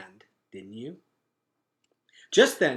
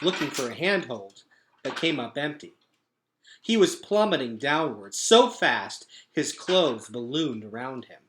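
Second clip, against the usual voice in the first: usual speaking voice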